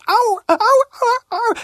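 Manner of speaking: fox crying out in high yelps; high pained oh's. Acted. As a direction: yelp from the whack on the nose. Fox hit on nose.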